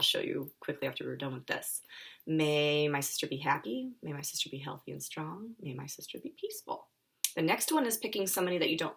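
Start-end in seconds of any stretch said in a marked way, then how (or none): 2.3-6.9 calm meditation voice; guided kindness phrases. Acted.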